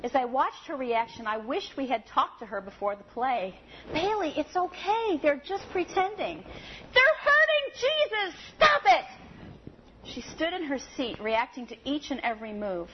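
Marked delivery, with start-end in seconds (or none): 3.9-6.4 higher, gentle reassurance; soothing grandmother. Retold or imitated. 6.9-9.1 put-on high, loud, slow desperate child; child's frantic scream.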